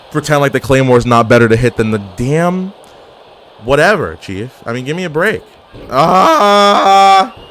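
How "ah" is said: long, loud high yell; drawn-out scream at the game; loud "aaah" held out; frustrated scream. Real, not acted.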